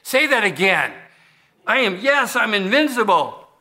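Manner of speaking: high, gleeful villain catchphrase; fans quoting the villain. Retold or imitated. Fans asking the actor to repeat his catchphrase, high and gleeful. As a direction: ham up the famous line. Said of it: "keep coming up to him and say".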